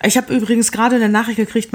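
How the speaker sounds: usual speaking voice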